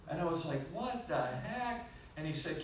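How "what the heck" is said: slow, high, incredulous question; retold bewilderment. Reacting to a daughter's diagnosis, slow high bewildered retelling. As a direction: stretch each word in disbelief.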